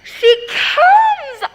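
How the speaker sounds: bursts out loud and high with a laugh; excited shout. Acted. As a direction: cry out in joyful excitement. Juliet sees the nurse arrive.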